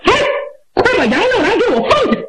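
put-on high-pitched, urgent young man's yell; high-voiced shout. A narrator's reading. Youth ordering strangers to release Yang Liulang, shouting high. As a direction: call out high and fast, as a command. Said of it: "高声喊喝".

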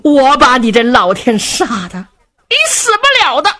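put-on high, loud scolding old woman; furious old lady. Old lady cursing the old prince, furious scolding. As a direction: curse him out as the enraged old mother. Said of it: "老太太气坏了".

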